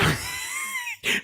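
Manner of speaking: very high squeal of laughter; helpless laughter. Real, not acted. Host loses it over the clip, high laughing. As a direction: dissolve into high-pitched laughing. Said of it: "you shouldn't laugh".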